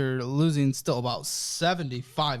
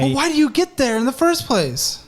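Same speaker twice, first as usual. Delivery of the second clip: loud, higher-pitched challenge; challenging; 8 semitones higher, 9 dB louder than usual. Real, not acted. Host cuts into guest's excuses, challenging. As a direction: break in loudly, pitched up.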